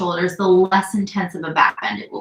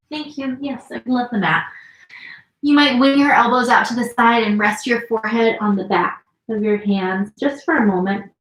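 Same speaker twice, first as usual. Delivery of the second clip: usual speaking voice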